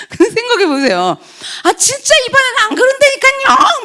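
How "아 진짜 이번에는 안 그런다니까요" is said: very high mock pleading; whiny insistence. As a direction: insist in a hammed-up high whine. Acts the repeat offender insisting. (높은 목소리로 억울한 듯 우기며)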